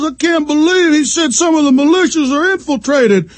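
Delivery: high, whiny outraged mimic; mock critic. Host parodying a critic to listeners, high whiny mock. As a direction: parody a fed stooge complaining. Mocking Hal Turner-type critic.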